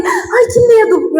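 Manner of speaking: slow, high, tearful gasp of fear; frightened cry. Retold or imitated. Alone in the dark room, sensing something, a frightened high cry. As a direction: let the fear crack through, slow and high.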